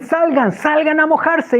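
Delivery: high, stretched summons; excited calling out. Retold or imitated. Man calling a couple out to get wet, excited holler. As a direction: urge them out into the rain.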